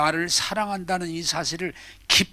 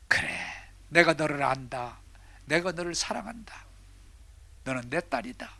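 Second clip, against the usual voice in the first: voice of God; 9 dB quieter than usual. Acted. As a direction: answer her as a gentle father. God answers the woman.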